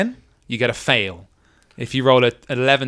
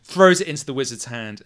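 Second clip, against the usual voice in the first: usual speaking voice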